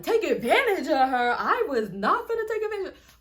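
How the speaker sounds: loud, high-pitched mock outrage; indignant outburst. Accused man protesting to her friend, loud indignant mimicry. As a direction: throw it back, offended and loud. Acting out the man's indignant reply.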